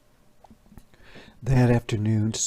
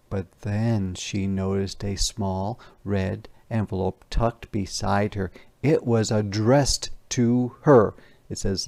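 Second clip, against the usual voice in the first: plain reading voice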